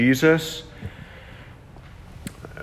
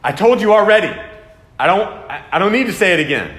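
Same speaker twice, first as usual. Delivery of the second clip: louder, higher exasperated parent; 7 semitones higher, 5 dB louder, faster than usual. Pretend. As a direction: snap at the kids you already said it. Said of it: "Ever, ever want to, tempted to do that with your kids".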